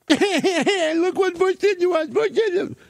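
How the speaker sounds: high, drawn-out mock whine; childish whining.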